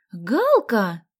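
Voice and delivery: loud, happy cry of greeting; delighted. A narrator's reading. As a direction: greet a long-missed friend joyfully. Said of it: "обрадовалась Соня".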